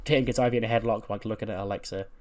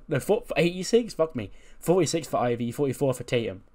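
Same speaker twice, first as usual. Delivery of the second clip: ordinary delivery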